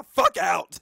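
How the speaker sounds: loud exasperated outburst; shouted punchline. Real, not acted. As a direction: blurt it out loud.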